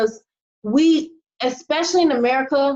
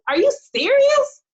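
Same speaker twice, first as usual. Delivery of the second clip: shocked high squeal; 14 semitones higher than usual. Real, not acted. To complainers about hard work, a high incredulous squeal. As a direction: pitch way up in disbelief.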